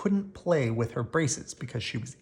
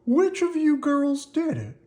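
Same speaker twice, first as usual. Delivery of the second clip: put-on old man voice, high; 13 semitones higher, 5 dB louder than usual. A narrator's reading. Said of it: "said Mister Hollander".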